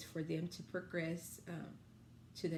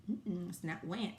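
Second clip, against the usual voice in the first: ordinary talk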